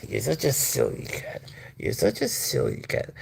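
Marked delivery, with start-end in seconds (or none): 1.8-3.1 much higher, whispered coo; high cooing pet talk. Real, not acted. Owner coos to the cat in a high whisper.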